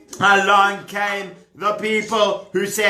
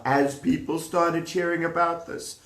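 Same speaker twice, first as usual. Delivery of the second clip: ordinary delivery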